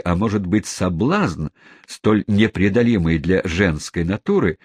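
usual speaking voice

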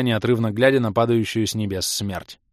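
usual speaking voice

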